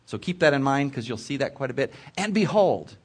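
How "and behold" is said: "And behold" set off like an exclamation point; emphatic announcement. A narrator's reading. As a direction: lift "And behold" higher to demand attention. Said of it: "It's like an exclamation point".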